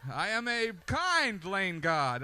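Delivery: shouting high, then slowing grandly; triumphant lane god. Retold or imitated. Driver in LA traffic, proud and shouting like a lane god. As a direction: crow about letting a car merge. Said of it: "you're like".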